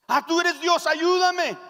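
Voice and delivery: high, loud cry for rescue; desperate plea. Acted. Hanging man begging God for help, desperate.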